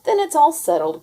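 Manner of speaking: high, loud cry; excited cry. A narrator's reading. Melly cries out the plan, high and loud. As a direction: exclaim it with glee. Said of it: "cried Melly".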